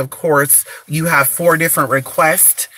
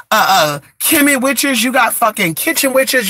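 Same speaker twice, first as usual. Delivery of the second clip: usual speaking voice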